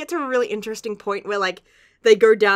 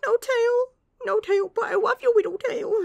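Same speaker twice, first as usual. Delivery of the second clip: much higher, cooing over the little tail; cutesy Yu voice. Retold or imitated. Yu fussing over the missing tail, in a high cooing voice. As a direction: coo it like a doting friend. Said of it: "Yu's like".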